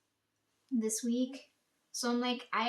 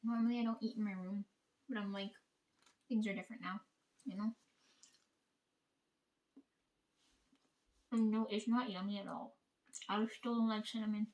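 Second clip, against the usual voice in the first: hushed; 2 semitones lower, 6 dB quieter than usual. Real, not acted. Hiding, he just came home.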